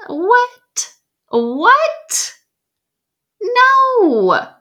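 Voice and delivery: high, squeaky "What? What? No."; a shocked high yelp of disbelief; incredulous disbelief. Real, not acted. Mock disbelief at excuses.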